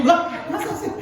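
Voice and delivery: a shocked, laughing "really?"; high incredulous laugh; surprised. Acted. Soldier hears the law changed.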